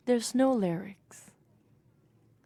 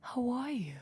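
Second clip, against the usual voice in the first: breathy voice; 7 dB quieter than usual. Retold or imitated. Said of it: "very breathy voice".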